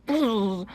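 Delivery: imitated buzz of a bee taking off. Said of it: "They'll just go".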